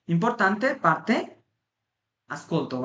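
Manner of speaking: each word drawn out slowly, x0.3 pace; slow Italian demo. Shown as a demonstration. Teacher to Bengali students, reading Italian very slowly. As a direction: spell out importante parte for the learners. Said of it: "slow Italian demo".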